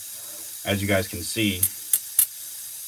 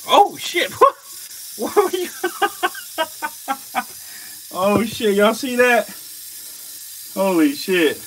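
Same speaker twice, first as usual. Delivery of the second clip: much higher, louder yelps broken by laughter; shrieking excitement; 12 semitones higher, 4 dB louder than usual. Real, not acted. Vlogger shrieks to viewers as snake strikes, excited laughing.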